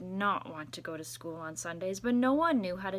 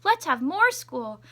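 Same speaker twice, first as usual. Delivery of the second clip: excited principal; 7 semitones higher, 6 dB louder than usual. Acted. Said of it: "called everyone together and said".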